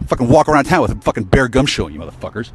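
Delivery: ordinary talk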